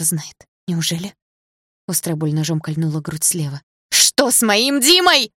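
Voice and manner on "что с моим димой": loud, high, drawn-out frantic cry; uncontrolled scream. A narrator's reading. Injured girl demanding news of her Dima, uncontrolled scream. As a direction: cry out slowly, losing control. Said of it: "закричала я громче, не контролируя себя".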